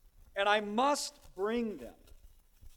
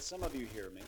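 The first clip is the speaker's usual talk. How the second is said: hushed invitation; 9 semitones lower, 9 dB quieter than usual. Retold or imitated. Spurgeon quoted, hushed.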